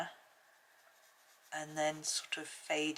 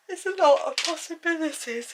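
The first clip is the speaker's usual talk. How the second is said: talking through a yawn; stretched yawn while speaking; 12 semitones higher, 11 dB louder than usual. Real, not acted. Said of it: "Excuse me yawning".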